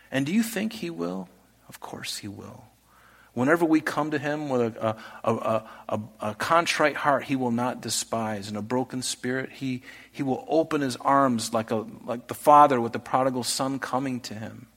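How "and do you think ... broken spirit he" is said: normal talking voice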